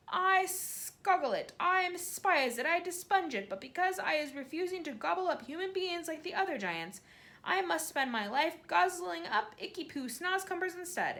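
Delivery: a big kind giant complaining about his vile vegetable; giant voice, disgusted. A narrator's reading. BFG voice held in dialogue.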